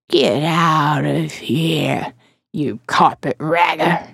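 low, very slow sneering crone; slow croaking old woman. Acted. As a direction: drag the insult out, low and mean.

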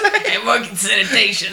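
laughing New York tough guy; Brooklyn accent. Retold or imitated. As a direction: play a Brooklyn sanitation worker. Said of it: "heavy Brooklyn accent".

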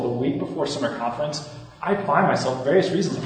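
ordinary talk, nothing marked

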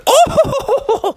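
awestruck high falsetto "oh"; thrilled squeal of discovery; Froggy. Acted. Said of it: "Froggy was like".

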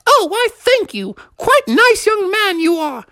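very high falsetto lady voice; Mother Bear. Acted. Mother Bear thanking the young man, fluty falsetto. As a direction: gush thanks in a put-on falsetto. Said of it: "And she said".

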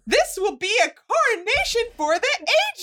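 rising to a laughing shout; boastful sorceress showing off, building up; grand queen boast. Acted. Voicing Casta's grand boast.

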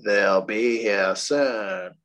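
put-on low, slow, eerie child voice; creepy kid. Shown as a demonstration. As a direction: drag it out like a spooky kid. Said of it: "let me say it like Creepy Kid".

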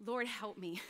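hushed prayer for help; quiet prayer. Shown as a demonstration. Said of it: "That was my prayer".

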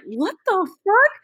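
high, slow, baffled inner voice; stunned inner what the fuck. Retold or imitated. To herself after the insult, high slow baffled disbelief. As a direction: let the disbelief stretch out high. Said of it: "In my head, I was like".

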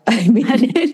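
breaks into a high laugh mid-sentence. Real, not acted. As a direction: laugh through the words, amused. Laughing at friend's joke.

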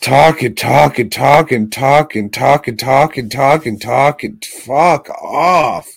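mocking nonstop chatter, building to an exasperated dismissal; exasperated repetition. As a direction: hammer it out on a loop, then snap. Mimicking endless movie chatter, exasperated.

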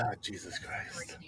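slow, breathy whispered groan of despair; exasperated whisper. Real, not acted. Host giving up on his pushy guest, whispered. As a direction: sigh it out under your breath, defeated.

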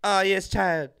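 lightly Jamaican psychic breaking bad news; Miss Cleo impression. Psychic telling a caller about her man, mock Jamaican. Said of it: "And be like".

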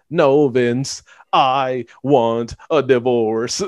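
slow, wooden deadpan imitation; mock deadpan, each word laid out slowly. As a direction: recite it like a terrible TV actor. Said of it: "in the most deadpan voice".

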